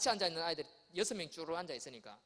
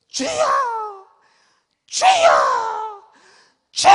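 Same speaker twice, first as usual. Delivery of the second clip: high, loud, drawn-out "주여!"; fervent preacher cry; 21 dB louder, much slower than usual. Retold or imitated. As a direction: call out to the Lord with great force. Preacher praying over child's head.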